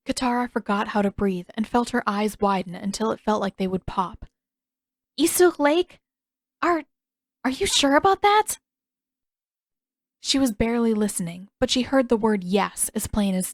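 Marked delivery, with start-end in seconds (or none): 5.2-8.6 high, stunned disbelief; shocked. A narrator's reading. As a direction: gasp it out in alarm. Shocked at news.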